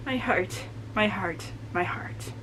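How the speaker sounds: soft, fading to a whisper; overcome, hushed. Real, not acted. Overwhelmed reader clutching at her feelings, hushed and fading.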